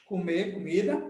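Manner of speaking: normal talking voice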